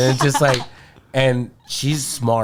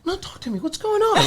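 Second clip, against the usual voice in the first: put-on calm boyfriend; 4 dB quieter than usual. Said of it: "pauses the movie".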